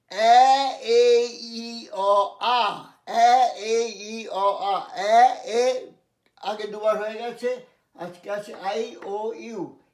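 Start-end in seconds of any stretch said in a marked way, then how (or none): 0.1-5.9 loud drilled short vowels, one by one; short vowel sounds. Shown as a demonstration. Teacher drilling students on short vowel sounds, loud and clipped. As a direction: bark each short vowel out for the class. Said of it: "short উচ্চারণের তুমি জানো".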